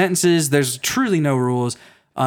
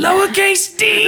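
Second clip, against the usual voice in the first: squeaky high voice; 4 dB louder, slower than usual. Acted. Joking correction of the spelling.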